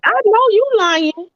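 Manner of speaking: high-pitched, drawn-out disbelief; incredulous retort. Retold or imitated. Viewer reacting to a healthy relationship claim, incredulous. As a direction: call out the fib in a high voice.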